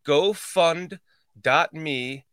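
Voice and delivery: ordinary talk, nothing marked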